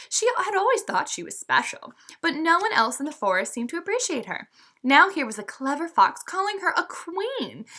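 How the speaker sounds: put-on lively read-aloud for young listeners; storybook narration. A narrator's reading. Teacher reading a fable to her students, lively storytelling.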